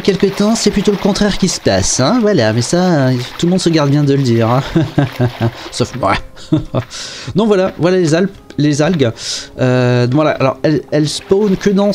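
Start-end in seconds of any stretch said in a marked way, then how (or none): 4.7-5.6 real low chuckle; amused chuckle. Real, not acted. Teasing viewers about Minecraft copying, amused chuckle. 6.2-7.3 soft, breath-only laugh; soft chuckle. Real, not acted. Owning up to the dig, soft self-amused chuckle. Laughs after "sauf moi.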